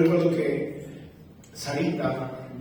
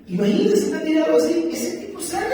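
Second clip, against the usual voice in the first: mock sufferer voice; 6 dB louder than usual. Mimics seizure victim being healed.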